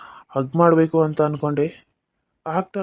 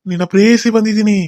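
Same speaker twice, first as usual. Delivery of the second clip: higher, louder eerie wail; louder ghost cry; 5 semitones higher, 7 dB louder than usual. Acted. Ghost lover wailing her arrival at Kishore. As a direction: push the call up into a wail.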